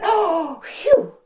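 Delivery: big gasp and high puffed exhale; gasp, then blow out in exaggerated relief; mock out-of-breath gasp. Storyteller finishes pretend running with children, theatrically puffed.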